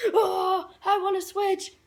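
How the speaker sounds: high, bright-eyed eager offer with a laugh; high giddy eagerness, mock-imitated. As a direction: jump at the chance, laughing. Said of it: "So I'm like".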